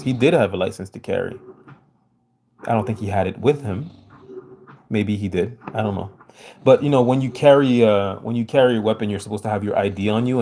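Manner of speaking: ordinary delivery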